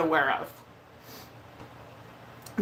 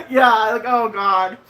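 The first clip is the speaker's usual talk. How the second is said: louder, a teary laugh breaking in; laughing through tears; 2 semitones higher, 6 dB louder than usual. Real, not acted. Asked by a friend if she is crying, teary laugh. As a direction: laugh it off while still crying. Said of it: "are you crying, [name]?".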